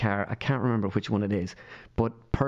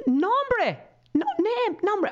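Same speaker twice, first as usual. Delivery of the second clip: high-pitched mimicry of mother; imitating puzzled mother; much slower than usual. His mother works out the Spanish word, imitated high and puzzled. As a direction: work out the foreign word, pitched way up.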